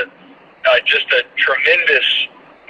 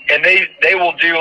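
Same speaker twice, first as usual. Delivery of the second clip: usual speaking voice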